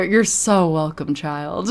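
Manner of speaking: playfully gracious, drawn-out reply; All Mother. Pretend. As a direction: bestow it slowly, like a benevolent matriarch. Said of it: "Thank you, All Mother".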